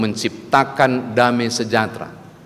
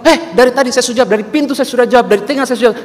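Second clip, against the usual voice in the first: loud, high, fast insisting yell; shouting back; 14 semitones higher, 8 dB louder, much faster than usual. Retold or imitated. Wife shouts back at her husband that she answered. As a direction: fire it back, louder each time. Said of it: "teriak-teriak".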